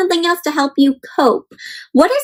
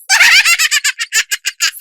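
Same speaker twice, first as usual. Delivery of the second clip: big laugh; 14 semitones higher than usual. Acted. Teacher acting out the girl laughing, big hammed-up laugh.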